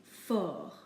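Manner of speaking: FORT as "for"; silent T. Shown as a demonstration. Teacher giving the final example, silent T. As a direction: say FORT with no T at the end. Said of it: "We do not pronounce the T".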